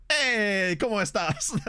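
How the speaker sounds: Spanish greeting in a hammed-up Welsh accent; playful mock Welsh accent. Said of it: "Just say it in a Welsh accent".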